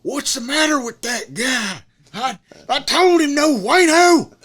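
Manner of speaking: high, loud, exasperated outburst, slowing at the end; the furious boss complaining about the worker, imitated. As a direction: blow up in disbelief. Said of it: "he goes".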